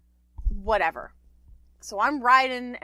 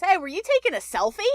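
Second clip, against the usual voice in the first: a light imitation of a drunk Irishman's thick Irish accent, higher and quick; 8 semitones higher, faster than usual. Drunk Irishman calling from a cab window, imitated in a light Irish accent. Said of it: "deeply thick Irish accent".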